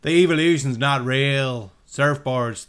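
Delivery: put-on a half-hearted Californian surfer dude accent; mock surfer dude accent, drawn out; bad surfer dude accent. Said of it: "that was the worst fucking accent ever".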